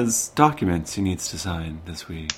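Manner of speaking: low, soft radio announcer voice; public radio host. Pretend. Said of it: "then you have to do a radio voice".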